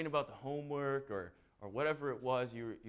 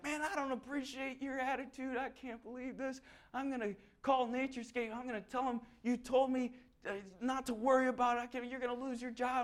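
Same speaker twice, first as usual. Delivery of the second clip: much higher, put-on threatening voice; indignant customer; 11 semitones higher than usual. Angry customer threatening the worker's job: high indignant voice. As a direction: wag a finger and threaten.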